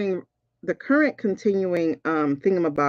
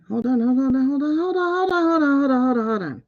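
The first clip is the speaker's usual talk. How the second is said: excited repeating; 6 semitones higher, faster than usual. Real, not acted. Excited by the card reading.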